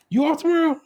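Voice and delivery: high mock son asking; son's question. Son asking, father retelling.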